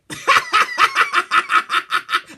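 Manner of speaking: loud burst of laughter; hearty cackle. Real, not acted. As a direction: crack up at yourself. Laughing at being called budget.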